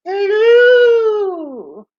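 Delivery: high, loud, long-drawn hooting greeting; Mrs. Doubtfire. Acted. Host greeting Kelly, high drawn-out Mrs. Doubtfire voice. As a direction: trill it out very high and stretched. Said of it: "Missus Doubtfire".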